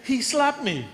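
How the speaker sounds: put-on a little boy telling on someone; whiny child. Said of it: "then he said".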